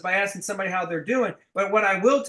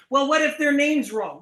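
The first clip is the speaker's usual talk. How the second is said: fearful rep; 8 semitones higher, 5 dB louder than usual. Retold or imitated. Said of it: "I don't play the fear-based".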